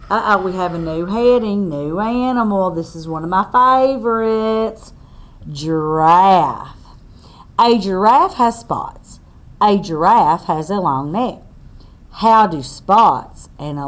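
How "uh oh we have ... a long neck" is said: put-on reading to children. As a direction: spell it out clearly for little listeners. Reads book text aloud.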